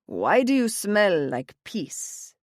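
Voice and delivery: slow, gruff Italian father; a stern dad sniffing suspiciously. A narrator's reading. Father voiced in story.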